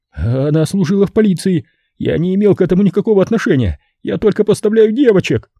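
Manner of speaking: high, frantic begging pimp voice; a terrified bar owner offering all his money; panicked pimp pleading. Acted. Terrified Simon begging the killer to take the money, high and frantic.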